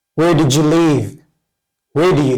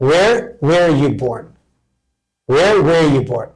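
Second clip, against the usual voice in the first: every word drawn out; very slow model; much slower than usual. Shown as a demonstration. Teacher repeating the question for students, very slow. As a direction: spell it out slowly in speech. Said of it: "slow demo after normal take".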